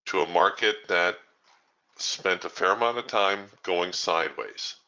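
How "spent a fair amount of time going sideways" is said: ordinary talk, nothing marked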